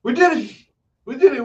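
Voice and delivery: high, loud triumphant shouts; excited cheer. Pretend. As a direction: cheer like you just won. Mock cheering old win.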